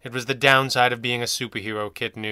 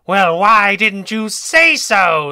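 manic shout, much higher and louder, slow; mad monkey; 8 semitones higher, 12 dB louder, much slower than usual. Acted. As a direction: explode into gleeful madness. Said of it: "the mad monkey said".

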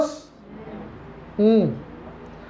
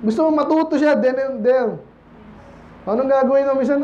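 ordinary talk

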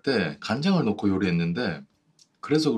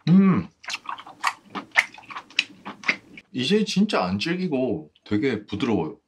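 talking with food in mouth; chewing while talking; 3 semitones higher than usual. Real, not acted. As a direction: hand over chewing mouth. Seen 0: Chewing visibly after biting tentacle; hand covers mouth from 3.1s.

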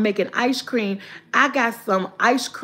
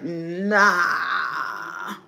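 dismissive nah; 7 semitones lower, 4 dB quieter than usual. Retold or imitated. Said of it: "I be like".

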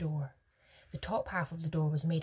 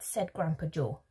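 plain reading voice

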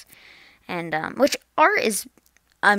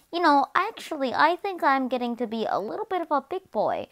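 lightly voiced second grader, pitched up; a hint of a proud little kid, higher voice; 7 semitones higher than usual. Second grader announcing he is growing up, lightly self-important. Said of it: "second grade".

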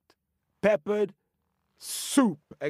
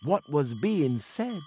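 plain reading voice

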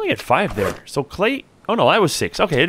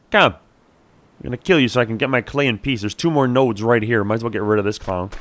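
ordinary delivery

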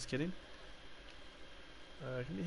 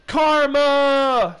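loud high shout; gamer shout; 7 semitones higher, 8 dB louder than usual. Real, not acted. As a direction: shout it out in triumph. Gamer shouts after a play.

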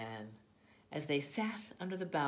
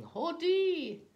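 high, loud arrival call; shouting; 10 dB louder than usual. A narrator's reading. First guests arriving at the house, shouting. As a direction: shout it out on a high held note.

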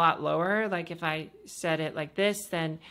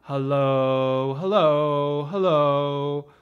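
low, slow, louder hellos probing the bottom; low test hellos; 2 semitones lower, 5 dB louder, much slower than usual. Shown as a demonstration. Coach testing how low the voice goes, deep hellos. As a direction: sound out hello low and heavy. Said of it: "So let's see here".

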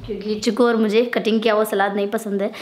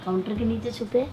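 hushed while hiding; 2 semitones lower, 9 dB quieter than usual. Real, not acted. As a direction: keep it down so she won't hear. Hiding for a prank.

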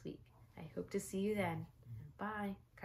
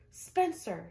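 loud, falling call of Spencer; screamed name; 9 dB louder than usual. A narrator's reading. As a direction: call the boy's name sharply. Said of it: "she screamed".

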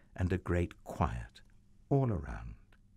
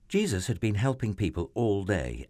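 plain reading voice

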